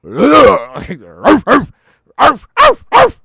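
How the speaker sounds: loud high dog barks arf arf; barking like a dog. Retold or imitated. Imitating [name] barking on stage. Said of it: "Can you imagine D M X".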